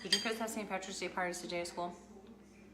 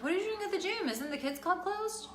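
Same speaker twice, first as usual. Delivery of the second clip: husband's voice; 13 semitones higher than usual. Retold or imitated. Husband voiced in retold call.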